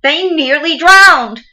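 high, loud, drawn-out cry of horror; anguished outcry. Acted. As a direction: let the horror burst out slowly. Climax of near drowning account.

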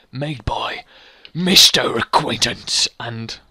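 slow, low announcer read; credits narrator. Acted. Player reading out the credits, grand narrator. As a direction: draw out the name grandly.

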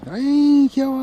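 high, loud adoring squeal; gushing coo. Real, not acted. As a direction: gush over a cute puppy, high. Cooing at a cute puppy.

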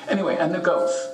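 ordinary delivery

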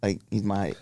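quiet, musing to himself; hushed, puzzled inner monologue; inner thought. Retold or imitated. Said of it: "right in my head".

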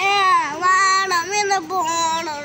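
loud, drawn-out demand. Real, not acted. Upset child insists on going. (വാശിപിടിച്ച് ഉറക്കെ)

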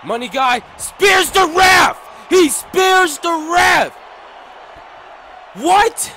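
high, loud disbelieving yell; shocked shouting. Real, not acted. Shocked at ref being speared.

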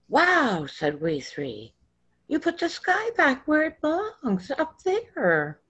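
put-on child marveling at Jesus; amazed child. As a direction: gasp it out in childlike awe. Child amazed as sky returns.

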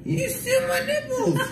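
high-pitched wailing yell; crying shout. Real, not acted. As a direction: wail it out in loopy panic. Drugged boy panics over nipples.

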